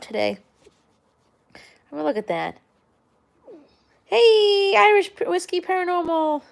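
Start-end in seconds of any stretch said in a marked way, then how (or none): 4.0-6.4 excited greeting. Real, not acted. Greeting a chat viewer.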